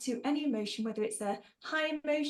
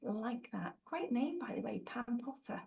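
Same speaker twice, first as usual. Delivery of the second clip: whispered aside; 6 dB quieter than usual. Real, not acted. Aside praising a name.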